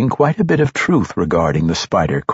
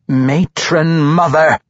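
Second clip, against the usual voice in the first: slow, loud, higher, each syllable spat; spitting outrage; 8 semitones higher, 4 dB louder, slower than usual. A narrator's reading. Quenthel furiously corrects Grumpf's address, spitting each syllable. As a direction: spit out every syllable in outrage. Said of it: "spitting every syllable".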